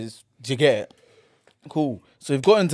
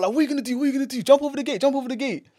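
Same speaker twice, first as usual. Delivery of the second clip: high-pitched retold taunt; mock confrontational goading; goading challenge; 8 semitones higher, much faster than usual. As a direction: egg them on in a high, rapid chant. Acting out Charles egging a man on.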